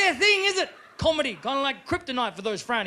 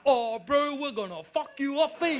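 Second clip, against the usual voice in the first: higher-pitched Maori heckler; Maori accent; 4 semitones higher than usual. Acted. Auckland crowd member threatening the comic, Maori accent. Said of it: "I did in Auckland on Anzac Day".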